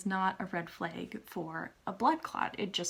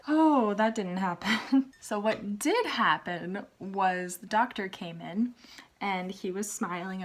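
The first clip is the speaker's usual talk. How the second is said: ordinary talk, nothing marked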